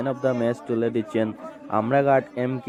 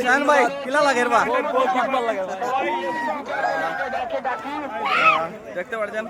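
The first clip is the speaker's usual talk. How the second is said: excited crowd reporting; 10 semitones higher than usual. Real, not acted. Reporter in cheering crowd after win.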